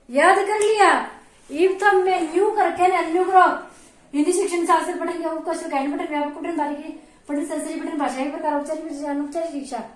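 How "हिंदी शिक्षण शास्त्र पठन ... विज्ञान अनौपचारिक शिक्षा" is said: low, soft, hurried mumble; cursory skim-reading. Shown as a demonstration. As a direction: skim through the words under your breath. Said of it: "इस तरह से जब तुम पढ़ा करो".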